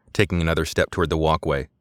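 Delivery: ordinary delivery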